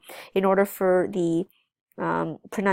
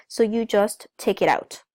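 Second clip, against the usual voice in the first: ordinary talk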